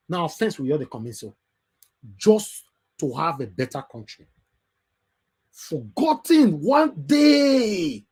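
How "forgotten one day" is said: drawn-out, slow warning shout. Real, not acted. Host warning listeners who stay silent, slow shouted warning. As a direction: stretch it out and shout it like a prophecy. Warning viewers of coming injustice.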